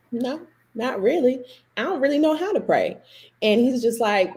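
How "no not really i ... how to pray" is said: high, small reluctant confession; sheepish admission. Retold or imitated. Upset graduate answering her pastor about prayer, sheepish. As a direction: admit it bashfully, pitched up high.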